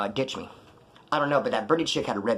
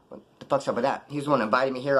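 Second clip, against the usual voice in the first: ordinary talk, nothing marked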